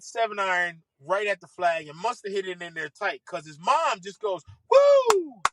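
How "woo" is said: high, loud drawn-out whoop; imitated mother's piercing cheer; mom's scream. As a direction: let out a big celebratory "woo". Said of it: "I ain't hear mom scream like that".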